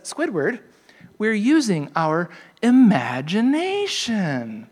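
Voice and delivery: slow, drawn-out wonder-filled cartoon voice; dreamy SpongeBob. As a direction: stretch it out like a magical reveal. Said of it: "he says".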